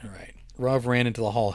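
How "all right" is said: whispered aside. Real, not acted. Unvoiced aside.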